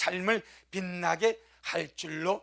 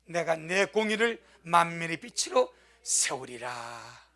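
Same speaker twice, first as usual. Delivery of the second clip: scripture recitation; 4 dB louder than usual. Acted. Leads congregation in reading verse.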